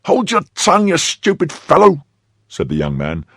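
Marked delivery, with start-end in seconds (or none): 0.1-2.0 high-pitched, loud rebuke; sharp scolding. Acted. Young man silences his panicking servant, sharp scolding. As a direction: scold him urgently to shut up.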